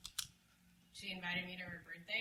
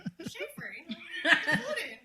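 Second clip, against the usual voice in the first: high, loud laughing protest; telling dad what a chafer is, laughing loud and high; laughing insistence; 11 semitones higher, 15 dB louder than usual. Retold or imitated. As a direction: insist through laughter, pitched way up.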